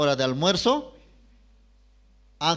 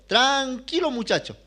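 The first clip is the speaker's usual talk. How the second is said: grandmother calming; 9 semitones higher than usual. Retold or imitated. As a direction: settle the boy down. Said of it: "me decía".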